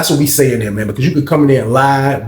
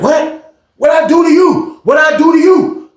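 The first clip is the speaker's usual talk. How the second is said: high-pitched, indignant challenge; mock-outraged, high protest; retold raised voice; 12 semitones higher, slower than usual. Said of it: "lower your motherfucking voice".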